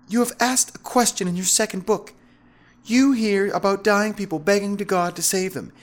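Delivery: high, menacing woman's voice; threatening female zealot demanding an answer; Veronica. A narrator's reading. Veronica threatens him with gun.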